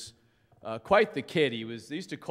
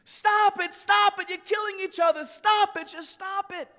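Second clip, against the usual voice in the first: very high, anguished repeated yell; screaming; 4 dB louder than usual. Retold or imitated. Angel holding dead kid, to the gangs, screaming. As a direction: scream out a desperate plea, imitating Angel. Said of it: "began to scream".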